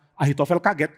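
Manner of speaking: ordinary delivery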